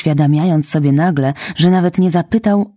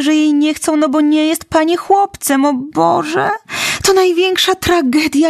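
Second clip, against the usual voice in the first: put-on high, fast orphan girl lamenting her tragedy; dramatic tearful girl; 12 semitones higher than usual. Orphan girl to Marilla, high and dramatically tearful. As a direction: pour it out like the greatest tragedy.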